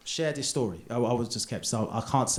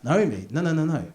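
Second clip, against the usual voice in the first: ordinary talk, nothing marked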